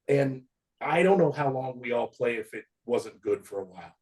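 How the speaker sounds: usual speaking voice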